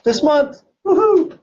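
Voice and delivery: high, loud woohoo of celebration; excited cheer. Real, not acted. Teacher cheers the record's anniversary to the class, excited.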